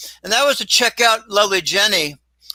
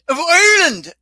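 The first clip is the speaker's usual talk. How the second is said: "of Ireland" in a hammed-up Irish lilt, higher and louder; Irish accent; 10 semitones higher, 5 dB louder than usual. Shown as a demonstration. Host correcting himself into a mock Irish accent. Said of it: "or I should say".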